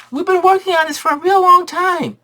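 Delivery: put-on squeaky high little kids calling Mommy; kid voices. Kids begging their mom to come see. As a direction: chirp it up high like excited little boys.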